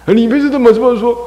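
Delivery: high, loud mock reproach; scolding mother. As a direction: act out a mother's exasperated scolding.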